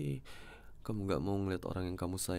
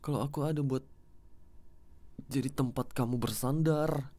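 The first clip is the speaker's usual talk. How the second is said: firm, slightly raised scolding tone. Acted. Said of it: "Maaf ya kalau nada aku tinggi tadi".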